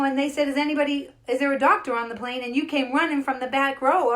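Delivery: high, loud, emphatic praise; rally him with mounting amazement; excited retelling. Retold or imitated. Reminding the doctor how he came running, excited.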